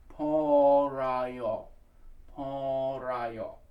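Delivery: "Paul Rayo" with a barely touched P and long vowels; very light P. Shown as a demonstration. Coach showing singers the very light P, drawn out. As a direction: stretch "Paul Rayo", softening the initial P. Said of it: "It's a very light P".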